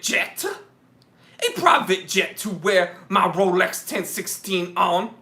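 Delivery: mock preacher pleading for money; hammed-up evangelist fundraising sermon; televangelist. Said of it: "uh, televangelists".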